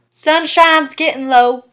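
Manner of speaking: slow, loud Southern twang; Southern accent. Shown as a demonstration. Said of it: "The O sound then becomes O".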